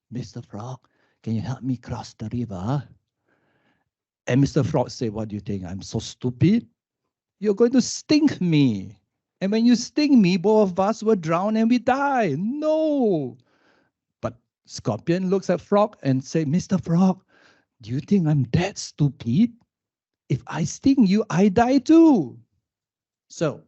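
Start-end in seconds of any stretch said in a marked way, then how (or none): 0.1-2.8 sly scorpion. Acted. Scorpion voiced in told fable. 5.1-13.4 an outraged frog who won't be fooled; indignant frog. Acted. Frog voiced refusing in fable. 16.4-22.4 a scorpion pleading its case; persuading scorpion. Acted. The scorpion talks the frog round, rising and insistent.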